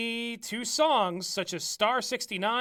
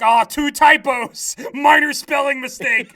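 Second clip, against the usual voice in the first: mock outrage; 4 semitones higher, 10 dB louder than usual. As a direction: bellow the nitpicks. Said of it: "we didn't need to be like".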